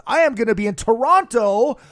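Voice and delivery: ordinary talk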